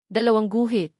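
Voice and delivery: usual speaking voice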